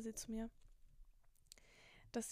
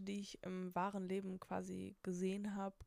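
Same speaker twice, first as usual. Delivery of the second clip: usual speaking voice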